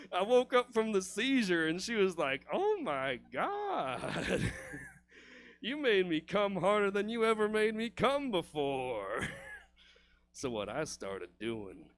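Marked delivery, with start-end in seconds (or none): 2.5-9.3 loud, drawn-out awestruck cry; mock ecstatic amazement. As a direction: play her overwhelmed with pleasure, hammed-up. Imitating the woman's amazed reaction.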